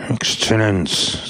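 slow, hesitant formal address; reluctant start. A narrator's reading. Pepone standing before the bishop, haltingly respectful. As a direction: begin with difficulty, slowly. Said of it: "fiel es ihm nicht so leicht".

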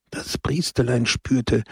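ordinary delivery, nothing marked

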